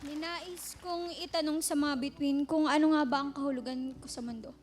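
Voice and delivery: loud, passionate poem recital; spoken word poetry. Acted. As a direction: declaim the poem with building fervor.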